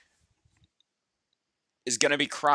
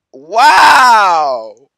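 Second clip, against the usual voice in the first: long loud stretched cry; loud drawn-out wow; incredulous wow; 4 semitones higher, 16 dB louder than usual. Real, not acted. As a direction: shout it in outraged disbelief.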